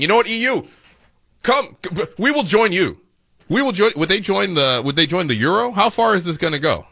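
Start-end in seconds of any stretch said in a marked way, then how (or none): 0.0-3.9 lightly voiced prime minister, higher and eager; mock Carney. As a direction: play the Canadian leader eagerly offering to join, a bit higher. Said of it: "and is saying".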